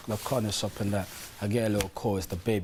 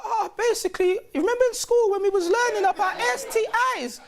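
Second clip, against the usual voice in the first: much higher whiny girlfriend impression; mock baby mum voice; 4 dB louder than usual. Mocking the baby mum's nagging in a high voice. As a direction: pitch way up to mock her nagging.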